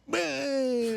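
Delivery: high, long drawn-out wail; mock Italian attack. Boss seeing ex-workers return, hammed-up Italian fit. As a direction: stretch one word into a high mock fit. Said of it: "an Italian attack or whatever".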